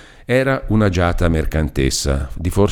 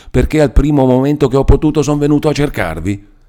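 Renzo furious. Acted. Renzo angrily pours out his grievance to Lucia. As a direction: let the anger build to a cry.

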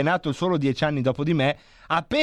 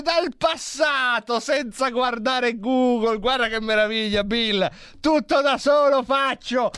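triumphant crowing; 7 semitones higher than usual. Acted. Triumphant over solving it.

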